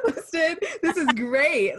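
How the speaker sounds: high, loud words through laughter; delighted, real laughing delivery. Real, not acted. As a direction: keep talking as the laugh takes over. Laughing after friend's mock dismay.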